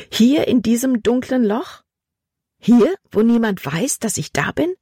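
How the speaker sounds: high-pitched, disbelieving questions; frightened girl asking. A narrator's reading. Trapped girl asks God in a dark hole, disbelieving. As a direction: ask upward in disbelief.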